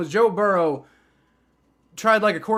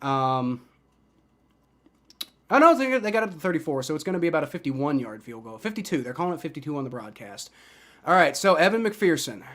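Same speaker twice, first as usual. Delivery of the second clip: ordinary talk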